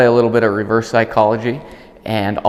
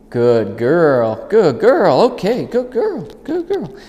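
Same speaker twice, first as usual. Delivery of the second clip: dog praise; 7 semitones higher, much slower than usual. Real, not acted. Praising dog after recall.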